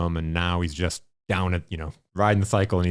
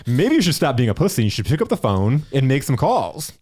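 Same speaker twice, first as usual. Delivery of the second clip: higher and louder, pushing hard; louder repeat; 7 semitones higher, 6 dB louder than usual. Retold or imitated. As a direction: repeat it harder, really telling him.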